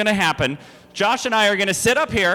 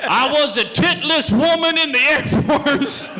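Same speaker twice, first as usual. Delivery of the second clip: laughing; 7 semitones higher, 3 dB louder than usual. Retold or imitated. Mocks guest's line laughing.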